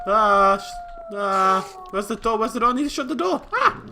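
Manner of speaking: frantic high shouting; panicked. Real, not acted. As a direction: scramble in panic. Creeper at the door.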